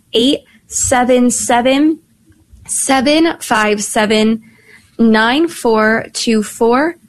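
slow, spaced digit groups; radio call-in number, drawn out with pauses; reading out phone number. Real, not acted. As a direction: read the number slowly for callers to note.

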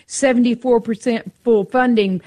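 ordinary talk

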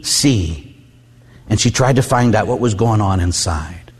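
ordinary delivery

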